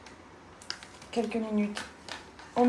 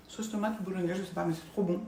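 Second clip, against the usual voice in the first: mouth full; 4 dB quieter, faster than usual. Real, not acted. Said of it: "on parle pas la bouche pleine".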